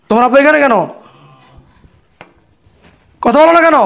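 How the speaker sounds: high-pitched confrontational questioning; stern demand. Acted. Father-in-law finds the hidden man, stern demand. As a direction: demand an explanation.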